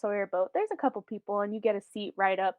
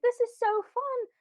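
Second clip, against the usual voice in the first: put-on very high, gleeful kid voice; delighted child; 13 semitones higher than usual. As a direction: squeal it like a thrilled child. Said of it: "They're like".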